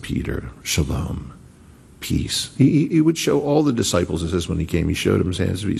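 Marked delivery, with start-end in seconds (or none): 0.0-2.5 low, soft shalom, fading to a breath; tender hush to a friend; soft, hushed greeting. Retold or imitated. Risen Jesus greeting Peter, soft and hushed.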